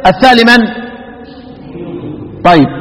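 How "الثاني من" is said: normal talking voice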